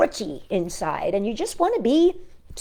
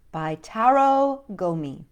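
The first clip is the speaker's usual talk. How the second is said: ordinary delivery, nothing marked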